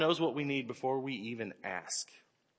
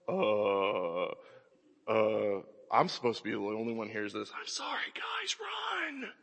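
put-on awkward panicked kid; 5 semitones lower, 6 dB quieter than usual. Kid whose dad is yelling at his friends, nervously hushed. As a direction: stammer in embarrassment then tell friends to run. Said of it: "I'm like".

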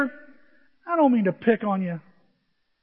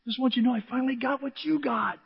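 higher, earnest mock announcement; imitated happy brother; 4 semitones higher, 4 dB quieter than usual. Brother phoning on wedding night, happily announcing. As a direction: lift it with pleased pride.

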